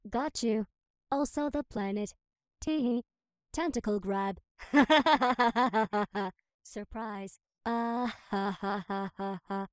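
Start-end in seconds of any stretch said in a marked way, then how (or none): 4.6-6.3 loud evil laugh. Acted. Villain gloating over victory.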